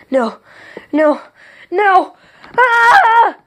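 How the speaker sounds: rising nos into a high shriek; building terrified scream. A narrator's reading. King screaming as he is put into the chamber, terrified. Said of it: "King Puramalum screamed".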